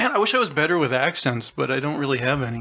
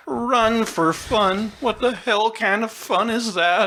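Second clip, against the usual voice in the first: high, drawn-out movie-character impression; exaggerated incredulous cartoon voice; cartoon side character; 7 semitones higher, slower than usual. Doing the movie side character, hammed-up high voice. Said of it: "That's pretty good".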